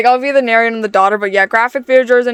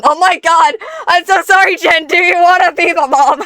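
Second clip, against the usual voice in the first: laughing; 7 semitones higher, 4 dB louder than usual. Real, not acted. Laughing apology to Jen.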